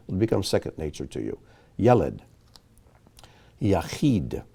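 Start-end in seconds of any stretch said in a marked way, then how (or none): 1.8-2.2 Yeled with the accent on YEL, falling after it; first-syllable stress. Shown as a demonstration. Teacher repeating an exception to the rules, Yeled stressed on YEL. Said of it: "accent comes on the first syllable".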